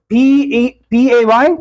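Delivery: ordinary talk, nothing marked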